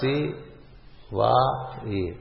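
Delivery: Si, Va, E spelt out separately; sound by sound. Shown as a demonstration. Teacher breaking down Shivai, each sound said apart. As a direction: leave a pause between Si, Va and E. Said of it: "E is not there".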